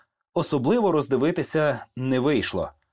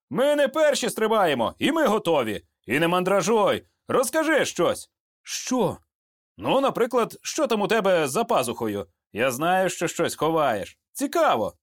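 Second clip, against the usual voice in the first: lightly voiced soldier, higher pitch; hint of a prying buddy, pitched up; chatty, curious comrade; 6 semitones higher than usual. A narrator's reading. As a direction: coax a nervous friend into talking. Yakiv chats to distract nervous student.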